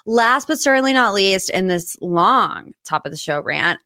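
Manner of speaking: usual speaking voice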